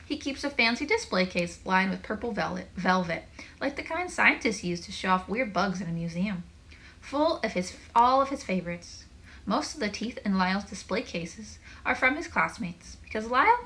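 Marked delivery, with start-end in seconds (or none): none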